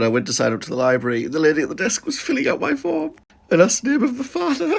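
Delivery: normal talking voice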